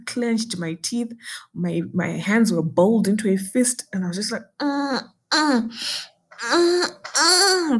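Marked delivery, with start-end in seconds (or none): 4.6-7.8 much higher strained uh-uh groans; stifled pain groans. Retold or imitated. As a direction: hold the pain in, groaning through teeth. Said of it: "trying not to make noise".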